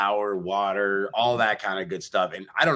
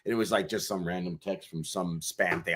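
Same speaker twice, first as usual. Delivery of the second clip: ordinary talk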